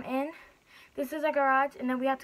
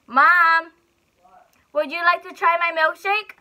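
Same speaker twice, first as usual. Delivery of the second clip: louder and higher call to another room; 7 semitones higher, 9 dB louder than usual. Real, not acted. Calling to mom in the house, raised voice.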